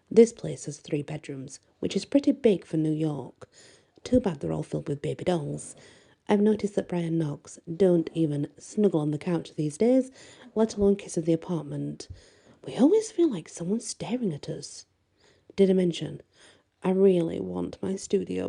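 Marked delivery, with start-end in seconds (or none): none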